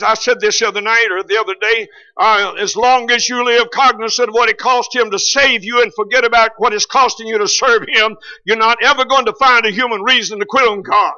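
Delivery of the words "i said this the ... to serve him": ordinary talk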